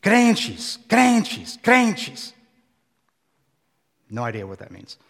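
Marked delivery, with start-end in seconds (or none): 0.0-2.3 half-voiced scream, each word pitched high and falling; imitated screaming. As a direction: imitate a man shrieking one word over and over. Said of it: "he starts screaming a word".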